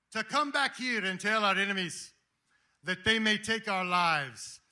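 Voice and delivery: shouted Scottish battle speech, slow on key phrases; Scottish accent, Braveheart. Acted. Speaker as Wallace daring his army to fight, shouted in Scottish accent. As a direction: build to a roaring Scottish cry of freedom. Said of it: "should I do it in a Sc-, Scottish accent".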